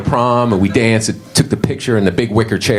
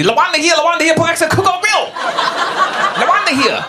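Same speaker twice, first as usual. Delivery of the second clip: very high, sung-out calls of her name; excited staff calls. Retold or imitated. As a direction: staff announcing a regular, shrieking with joy. Restaurant staff going crazy.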